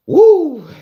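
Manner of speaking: laughing high whoop; excited whoop. Real, not acted. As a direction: let out a loud happy whoop. Hypes up a chat supporter.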